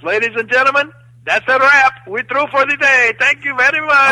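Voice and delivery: loud, very high mock director's announcement; megaphone announcement. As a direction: proclaim it to the whole crowd. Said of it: "He gets the megaphone".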